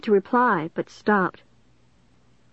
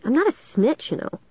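higher-pitched impatient protest; 6 semitones higher than usual. A narrator's reading. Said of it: "I told them impatiently".

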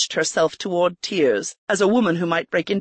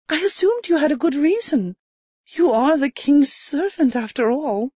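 trembling voice; 8 semitones higher than usual. A narrator's reading. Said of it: "Her voice trembled".

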